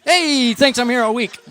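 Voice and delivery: high, loud sing-out punchline tag; hammy comedian catchphrase. Retold or imitated. Comedian congratulating himself after a pun, loud high hammy call. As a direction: crow it after your own joke. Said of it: "then I'll go".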